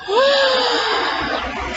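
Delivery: long high breathless gasp. Acted. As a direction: draw the breath in with awe. Boy astonished at window.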